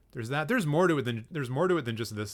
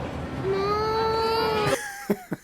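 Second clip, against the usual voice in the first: helpless laughter; 5 dB louder than usual. Real, not acted. Kids crying in clip.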